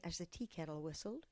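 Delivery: ordinary delivery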